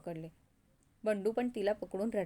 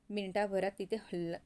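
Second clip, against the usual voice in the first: usual speaking voice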